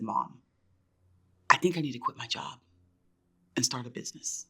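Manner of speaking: soft, low retold confession, broken by pauses; hushed confession. Daughter tells mom she wants to quit, hushed confession. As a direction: break it to her quietly and haltingly.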